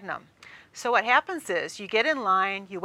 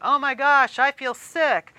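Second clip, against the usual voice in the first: slightly higher, louder complaint; a queasy friend; 4 semitones higher, 5 dB louder, slower than usual. Retold or imitated. Friend next to you groaning after the ride. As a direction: groan that you're dizzy. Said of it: "the friend next to you is going".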